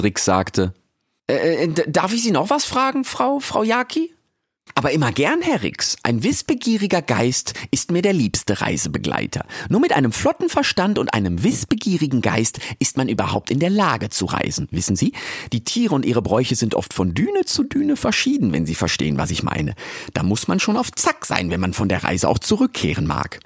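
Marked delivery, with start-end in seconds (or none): 1.3-4.1 put-on high, halting young voice; timid Rix. 4.7-23.4 higher, lively lady's voice; Frau Jaki. Acted.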